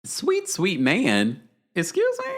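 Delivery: slow drawn-out sweet sweet man, then a sky-high excuse me; hammed-up shocked squeal on excuse me; mock outrage. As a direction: feign scandalised disbelief. Mocking a viewer's sweet man claim.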